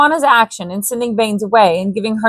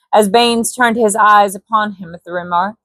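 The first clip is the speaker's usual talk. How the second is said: ordinary delivery, nothing marked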